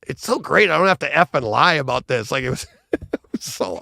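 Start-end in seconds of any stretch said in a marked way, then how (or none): 0.1-2.3 high, bright-eyed put-on actor voice; giddy imitation of the actor; mock happy actor. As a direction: play the relieved actor, gushing. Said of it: "He goes".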